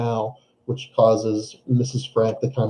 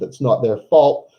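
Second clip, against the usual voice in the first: ordinary delivery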